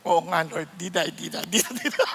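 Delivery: sheepish reply. Retold or imitated.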